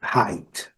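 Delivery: Height as /hait/, silent GH; English height. Shown as a demonstration. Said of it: "pronunciar o I com som de A". (Height como /hait/)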